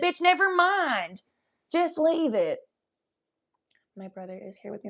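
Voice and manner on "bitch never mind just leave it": high, drawn-out mock giving up; exasperated dismissal. Telling the waxer to stop, mock exasperated. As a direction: call it off high and slow.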